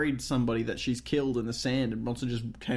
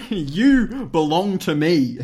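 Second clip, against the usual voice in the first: slow, loud hammed-up claim; mock possessive; 3 semitones higher, 6 dB louder, much slower than usual. Teacher claiming the pupils, hammed-up possessive. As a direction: draw it out with mock menace.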